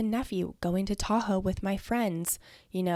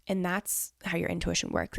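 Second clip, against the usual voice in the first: normal talking voice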